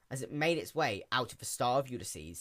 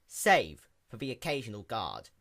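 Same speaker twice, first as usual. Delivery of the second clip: usual speaking voice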